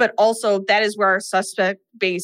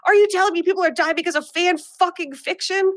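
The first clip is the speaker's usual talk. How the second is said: mock scream; 8 semitones higher than usual. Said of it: "Sam looks at Mindy and screams".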